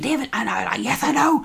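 very high, overwhelmed squeaky voice; mock frantic squeal. Acts himself frantic after the photo.

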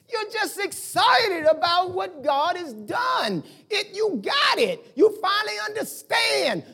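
high, slow, drawn-out exclamations; fervent preaching. Real, not acted. Preacher telling congregation what God has done, fervent preaching. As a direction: preach it with rising excitement, stretching key words.

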